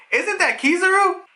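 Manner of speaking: high-pitched, stunned realization; imitated alarm. Acts out Nami spotting the admiral.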